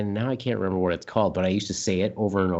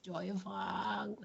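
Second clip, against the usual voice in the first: high, soft, drawn-out mock Chinese accent; 10 semitones higher, 10 dB quieter, much slower than usual. Host repeating the restaurant name, mock Chinese accent. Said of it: "you have to say it in an".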